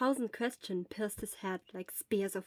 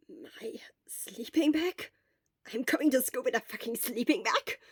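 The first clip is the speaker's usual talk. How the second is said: incredulous; 2 semitones higher than usual. Acted. Incredulous inner reaction.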